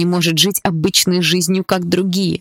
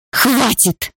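high cry, pitched way up; desperate scream; 8 semitones higher than usual. A narrator's reading. Girl screams at stepfather to stop the beating, high cry. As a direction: shriek to make it stop. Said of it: "закричала я".

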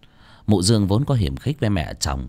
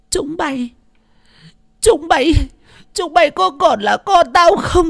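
very high screech, building louder; shrieking anger; 6 dB louder than usual. A narrator's reading. Old mother confronting her wicked children at night, shrieking. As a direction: screech it at them, repeating the address. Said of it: "Bà già chỉ tay rít lên".

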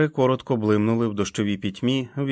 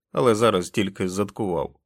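usual speaking voice

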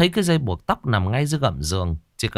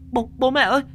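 terrified scream for help. A narrator's reading. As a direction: shriek for help, trapped and panicking. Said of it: "miệng la hét".

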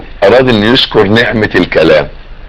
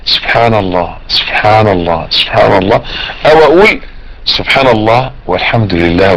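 calm, measured phrases, slightly lower; unhurried dhikr; 5 semitones lower than usual. Shown as a demonstration. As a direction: take each phrase at ease, clearly separated. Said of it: "اما اقعد اقولهم على مهلي".

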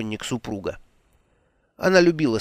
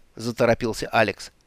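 usual speaking voice

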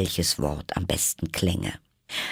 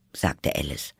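ordinary delivery, nothing marked

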